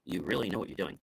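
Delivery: low, soft, unconvinced sarcasm; sarcastic. Shown as a demonstration. Speaker showing how tone flips praise, low sarcasm. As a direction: say it quietly, without believing it. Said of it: "What if I said it like this?".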